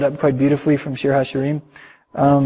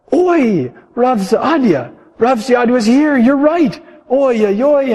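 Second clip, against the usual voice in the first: much higher, louder wailing oi; anguished cry; 8 semitones higher, 6 dB louder, slower than usual. Acted. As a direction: cry out in dismay, clutching his head. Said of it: "grabbed his head and cried".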